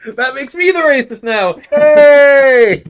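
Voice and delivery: very high, very loud yell; mock-outraged shout. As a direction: holler it, stretching the last word out. Mock outrage at co-host, laughs after.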